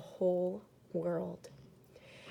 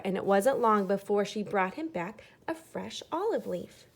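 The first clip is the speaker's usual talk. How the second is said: ordinary delivery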